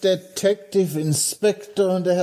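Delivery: high-pitched "ah, there you are" welcome; delighted greeting. Acted. Host hails the inspector and the doctor on arrival, delighted. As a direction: greet them in a high, lifted voice.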